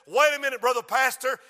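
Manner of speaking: loud Mississippi preacher imitation; Mississippi accent. Brother Percy stopping the pastor, Mississippi accent. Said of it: "in his Mississippi accent".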